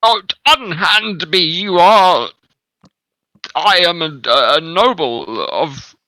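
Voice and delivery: loud, high, indignant noble; hammy stage actor. Acted. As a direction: bluster like an outraged aristocrat. GM plays indignant captured noble.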